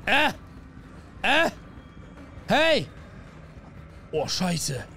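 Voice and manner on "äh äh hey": short, high, loud shrieks; real jump-scare cries; startled yelps. Real, not acted. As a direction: yelp in sudden fright.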